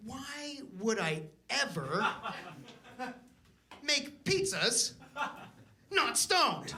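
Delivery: slow, dazed stoned voice; slow stoned drawl. Retold or imitated. Answering the girlfriend, slow stoned voice. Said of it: "I was like".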